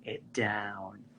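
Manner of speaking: very slow, low and soft; exaggerated slow motion. Echoing the casting director, low exaggerated slow motion. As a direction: drawl it out, dropping the pitch.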